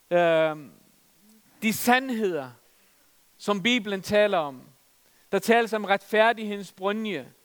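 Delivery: ordinary talk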